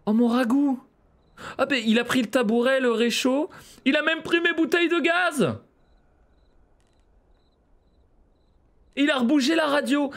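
high, loud gamer outrage; indignant outcry. Real, not acted. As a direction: cry out at each missing thing, pitch way up. Gamer finds his hut ransacked.

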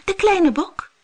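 small goat speaking up; little goat. Acted. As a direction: squeak it like a tiny kid goat. Little goat answers monster.

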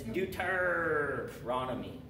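long growled pirate R in the middle of Deuteronomy; pirate accent. Shown as a demonstration. Teacher coaxing the class into a memory trick, pirate accent. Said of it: "just do your best pirate accent".

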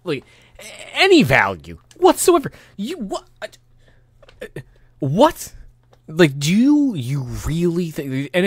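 high-pitched, broken disbelief; incredulous sputter. Real, not acted. Ranting that their opinion has no value, incredulous sputtering. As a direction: sputter in outrage, pitch jumping way up.

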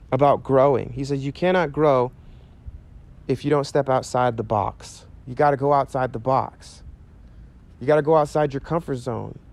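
ordinary delivery

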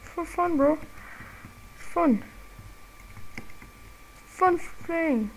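high, sarcastic answer; sing-song it back sarcastically; mock sarcastic reply. Gamer answering a critic, a high sarcastic reply. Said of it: "I'm like".

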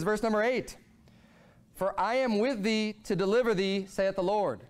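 ordinary talk

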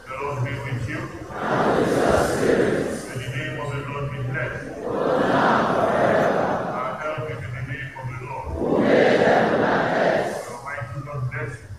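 distant echoing church voice; cathedral echo. Real, not acted. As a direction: far off in a reverberant church. Distant mic in church hall.

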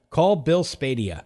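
slow, deliberate command to a phone. Real, not acted. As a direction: spell it out slowly for voice recognition. Repeating the command to Siri.

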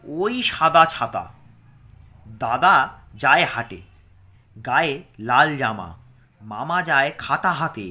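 loud rhyme reading. Acted. Teacher reads the rhyme aloud to pupils, emphatic. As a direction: call the rhyme out clearly to the class.

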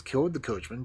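ordinary delivery

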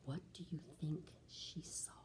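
put-on whispered question to the kids; asking the children in a hush. A narrator's reading. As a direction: whisper it like a secret.

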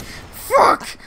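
high yelp. Shown as a demonstration. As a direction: squeak it out as a hiccup jolt. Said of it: "Like that!".

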